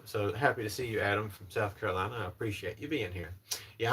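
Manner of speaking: ordinary delivery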